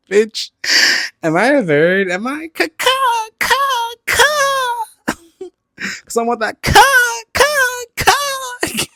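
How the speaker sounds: high squawking ca- ca- ca-; mock bird caw. Joking she's a bird, squawking high caws.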